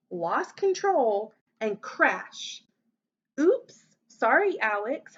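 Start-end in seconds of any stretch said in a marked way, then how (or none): 3.4-5.2 high, loud oops and hasty sorry; startled storybook apology, dropping at the end; quick apology. A narrator's reading. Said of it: "Hudson said quickly".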